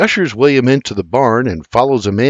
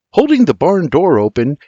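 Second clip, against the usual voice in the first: plain reading voice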